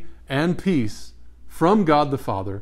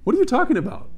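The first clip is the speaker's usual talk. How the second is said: much higher, incredulous squeak; baffled listener; 12 semitones higher, faster than usual. Pretend. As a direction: act stunned by a strange phrase. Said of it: "People would be like".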